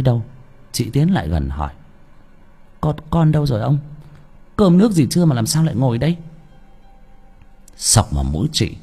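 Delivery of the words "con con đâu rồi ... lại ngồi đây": higher, worried wife's voice; anxious questioning. A narrator's reading. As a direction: press him with hurried questions. Anxious wife questions absent-minded husband. (giọng vợ lo lắng gặng hỏi)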